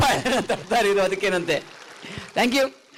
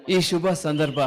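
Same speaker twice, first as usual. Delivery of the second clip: ordinary delivery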